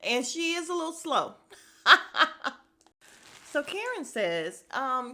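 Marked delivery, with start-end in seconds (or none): 1.8-2.5 real cackle, high and loud; loud laugh. Real, not acted. Host mocks a slow castmate, cackling loudly. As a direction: burst out laughing at your own jab. Host cracks up at her remark.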